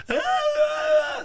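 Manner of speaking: high mock scream of terror; hammed-up horror scream. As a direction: shriek like a frozen horror victim. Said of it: "The mom sits here".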